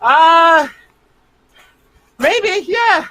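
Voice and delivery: high-pitched, noncommittal answer with long pauses; hesitant. Real, not acted. Dad asked if his daughter will join the team, hesitant. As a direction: stall on a high uh, then give a reluctant maybe. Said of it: "hear the hesitation in [name]'s voice".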